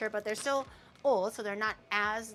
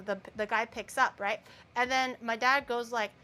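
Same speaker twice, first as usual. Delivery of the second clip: ordinary delivery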